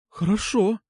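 high, unsure woman's agreement; hesitant. A narrator's reading. Алёна half-agrees to promise, hesitant. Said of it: "неуверенно произнесла она".

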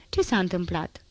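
plain reading voice

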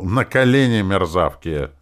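loud, high, harsh order; forceful shouted command; rough command. A narrator's reading. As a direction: order them roughly, raising your voice. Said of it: "Я грубо приказал".

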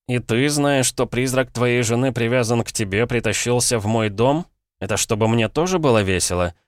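ordinary delivery

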